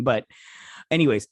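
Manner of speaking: ordinary talk, nothing marked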